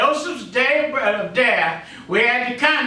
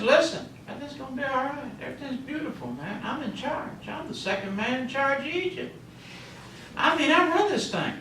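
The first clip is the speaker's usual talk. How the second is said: Joseph's easy boast, softer and higher; reassuring brag; 5 semitones higher, 8 dB quieter than usual. Acted. Joseph reassures his brothers he's in charge, soft brag. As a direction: voice him quietly, pitched up.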